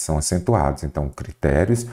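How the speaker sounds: ordinary delivery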